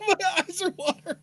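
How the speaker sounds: very high, words squeezed by laughter; laughing to tears. Real, not acted. Co-host admits to watering eyes, laughing hard. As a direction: gasp it out mid-laugh. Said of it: "laughing so hard you have tears".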